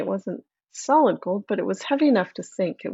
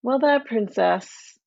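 ordinary delivery, nothing marked

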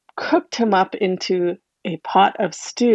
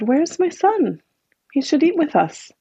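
puzzled father; 7 semitones higher than usual. Acted. Father asks for his son.

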